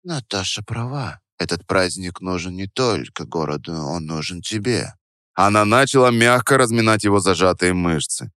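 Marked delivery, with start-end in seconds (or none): 0.0-4.9 soft, low seductive purr; low velvety voice. Acted. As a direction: velvet voice, coaxing him. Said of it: "Голос стал совсем другим, низким, бархатным".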